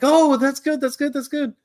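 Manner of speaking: very high-pitched mock reaction; imitated approval. Family answering news that Japan beat Brazil, high imitated approval.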